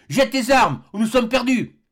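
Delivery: high, loud pleading shout; doctor shouting at Kennedy to drop weapons; desperate cry. Acted. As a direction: shout the order as if lives depend on it. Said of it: "s'écria le docteur".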